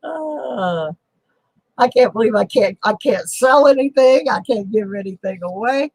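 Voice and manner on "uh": a short, high, quiet fake whimper of despair; half-hearted pretend cry; mock sob. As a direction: whimper it like a playful lament. Can't sell anything, mock despair.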